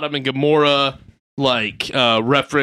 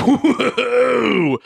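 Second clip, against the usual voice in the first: mock shock; 10 semitones higher, 3 dB louder than usual. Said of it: "you're like".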